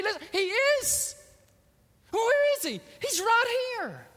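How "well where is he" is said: doubting listener. Retold or imitated. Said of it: "looking at me like".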